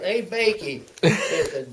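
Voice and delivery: high, loud holler across an office; retold yell. Soldier hollers to Bakey about a visitor, yelling. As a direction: call out loudly, stretching the words. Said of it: "So he yells out".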